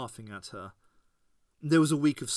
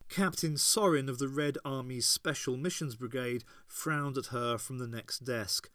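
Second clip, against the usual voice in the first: ordinary delivery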